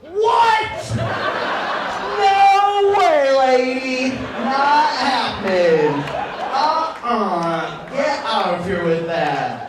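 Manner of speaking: high, loud, drawn-out comic shock; appalled refusal. Acted. Bert rejecting his girlfriend's request, loud and appalled. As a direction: shout it down in stretched-out disbelief.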